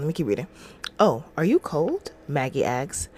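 usual speaking voice

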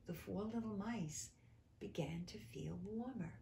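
soft, whispered narration; hushed reading. A narrator's reading. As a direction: let it sink into a hush. Reading softens to a hush.

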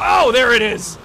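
loud thrilled shout; big excited call-out. Real, not acted. Excited reaction, repeated line.

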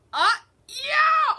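high, loud cheer; excited shout. Real, not acted. Player celebrating in the game, high excited shout. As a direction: whoop it out in triumph.

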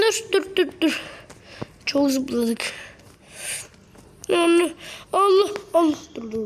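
ordinary delivery

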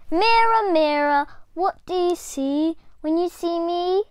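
put-on high, loud child's chant to the mirror; little girl calling. A narrator's reading. Little Diane calling to the magic mirror. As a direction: call out hopefully.

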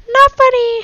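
loud, very high squeal; squealed complaint. Real, not acted. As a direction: squeal it at an annoying friend. Friend annoys streamer during game.

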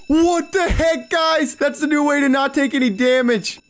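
high, laughing exclaim. Real, not acted. Gamer laughing at a bug to viewers. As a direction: crack up at the glitch.

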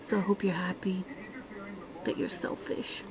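a sneering aside under the breath; whispered dig at the selfish; snide whisper. Real, not acted. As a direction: whisper the jab, all breath. Snide whispered jab at viewers.

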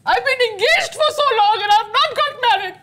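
usual speaking voice

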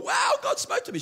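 high, thrilled imitation of her gasp of wonder; excited. Pastor imitating the church lady finding Malachi, excited and high. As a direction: retell her amazement, pitch leaping up. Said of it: "She got excited when she read Malachi".

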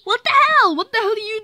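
mock shocked shout; startled outburst. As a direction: yelp it in shock. Startled finding Freddy there.

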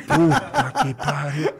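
laughing through the words. Real, not acted. Guest laughing to host about being caught, amused. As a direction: chuckle at being seen kicking the wheel.

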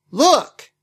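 sudden high loud blurt. Retold or imitated. Oski startles the others, sudden high blurt. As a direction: blurt it out all at once. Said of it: "Oski blurts out".

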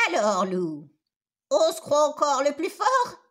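loud, menacing mother goat; scolding growl. A narrator's reading. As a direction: roar it at the tied-up wolf. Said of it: "gronda la maman chèvre".

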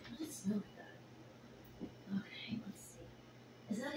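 slow, soft whisper; hushed slow whisper to herself. Real, not acted. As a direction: whisper so as not to startle them. Whispering while kittens settle.

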